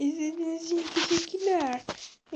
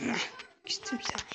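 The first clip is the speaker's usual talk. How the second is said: mock sulky whisper; 2 semitones lower, 10 dB quieter than usual. Mock sulking, unvoiced.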